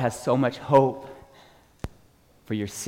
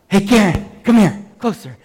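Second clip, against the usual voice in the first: loud, raised summons; calling over; 4 semitones higher, 8 dB louder than usual. Retold or imitated. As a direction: call a trainee to come closer. Retold calling Karen over.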